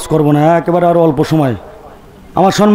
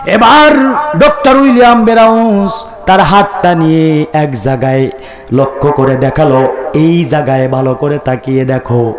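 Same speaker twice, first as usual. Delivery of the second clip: intoned sermon storytelling; 9 semitones lower than usual. Acted. Preacher telling how doctor showed the writing, intoned. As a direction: lower it and stretch out the sacred letters.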